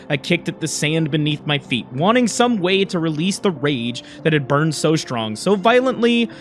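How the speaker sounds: low, regretful first-person narration; story narration. A narrator's reading.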